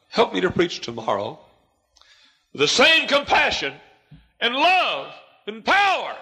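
building to a high, loud shout; a preacher's pleading, climbing prayer; fervent prayer. Real, not acted. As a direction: pray it with rising power. Praying aloud, rising fervour.

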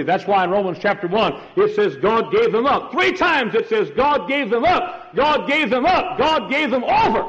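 high, loud preacher's climb; preaching at full force. Acted. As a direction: drive home God gave them up. Preaching climax.

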